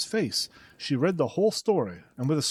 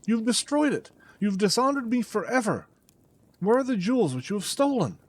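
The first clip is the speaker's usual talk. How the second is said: raging; 7 semitones higher than usual. A narrator's reading. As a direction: keep the fury going. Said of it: "beside myself with rage".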